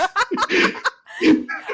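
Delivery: a high burst of giggling; giggle, high and delighted. Real, not acted. Player laughs at reveal.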